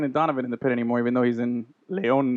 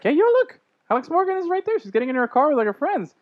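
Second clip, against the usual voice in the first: excited spotting; 11 semitones higher than usual. Retold or imitated. Spotting Alex Morgan by her car.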